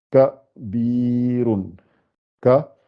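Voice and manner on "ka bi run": "bi" held long between "Ka" and "run"; lengthened i. Shown as a demonstration. Teacher showing the lengthening letter, "Ka, bi, run" with long "bi". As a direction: stretch the vowel in "bi", syllables apart. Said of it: "c'est pour allonger le i".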